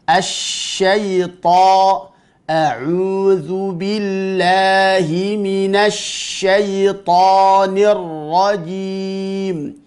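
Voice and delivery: slow measured chant, long SH in SYAITHANIR; shin given its full quality. Shown as a demonstration. Teacher demonstrating shin to students, slow recitation with lengthened SH. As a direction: linger on the hush of MINASY SYAITHANIR. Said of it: "tunaikan sifatnya".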